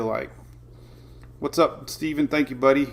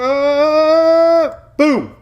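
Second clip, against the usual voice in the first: a loud, high whoop as the clock hits zero; excited cheer; 13 semitones higher, 8 dB louder than usual. Real, not acted. Host cheering as the countdown clock ends, loud and thrilled. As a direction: shout it like a buzzer-beater win.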